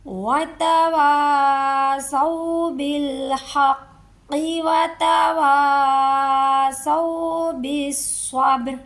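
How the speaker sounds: slow, drawn-out tajweed chant; Quran recitation. Shown as a demonstration. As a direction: recite it like a qari, stretching each sound. Recitation demo. (धीमी तजवीद वाली तिलावत)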